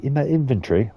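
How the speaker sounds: hammed-up amateur British; mock British accent. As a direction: put on an awful British accent. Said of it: "like I'm British or something".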